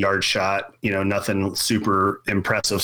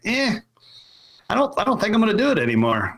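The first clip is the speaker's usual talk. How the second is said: high, fast, dismissive mock shrug; a quick, reluctant "eh" then a rushed excuse; reluctant brush-off; 11 semitones higher, faster than usual. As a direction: toss it off fast like you've lost interest. Retold reluctant reply to the owner.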